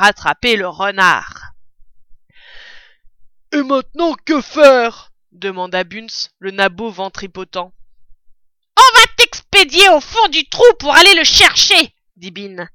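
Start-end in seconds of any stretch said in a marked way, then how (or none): 3.5-5.1 plus aigu et bien plus fort; question criée. Acted. Bunz demande, excité. 8.8-12.0 voix de brute, très aiguë et très forte; ordre brutal. Acted. Bin ordonne à Bunz.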